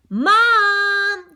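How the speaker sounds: long, high yell for Mom. A narrator's reading. Little sister calls Mom to tattle, high yell. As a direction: call out loudly, stretching the word. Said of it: "Georgia yells".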